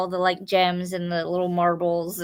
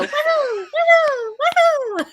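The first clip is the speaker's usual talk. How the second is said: high, far-off tiny cheer; cheering game magician; 6 dB louder than usual. Retold or imitated. Friend imitates magician celebrating a finished level, high cheer. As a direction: squeal each woohoo like the little celebrating sprite. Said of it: "and he'd go".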